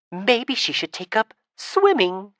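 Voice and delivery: mock suggestion. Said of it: "the third voice suggested".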